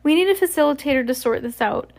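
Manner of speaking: high character voice. A narrator's reading. Amaros voiced in story.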